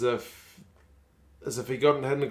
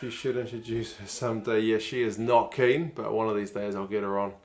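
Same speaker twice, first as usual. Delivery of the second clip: usual speaking voice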